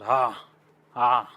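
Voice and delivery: ha pushed from the throat with a strong h; ha with effortful breath; rough ha. Shown as a demonstration. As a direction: strain the voice box on ha. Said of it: "Your voice box must struggle".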